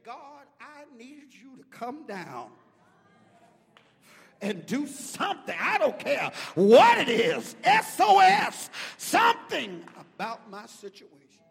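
building from a loud plea to a hoarse-free full roar, slow; desperate prayer. Shown as a demonstration. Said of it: "How many of you have ever said".

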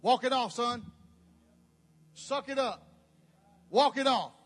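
loud gruff-free bark of a tough father; imitating a tough daddy. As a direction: order the kid to toughen up. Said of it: "What'd your daddy say?".

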